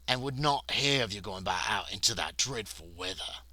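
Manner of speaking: a touch of the stately butler; butler explaining. Acted. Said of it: "he explains".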